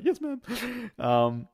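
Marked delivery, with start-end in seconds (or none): 0.0-0.4 high, timid yes ma'am; meek Homer voice. Retold or imitated. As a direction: squeak it out meekly. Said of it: "He's like".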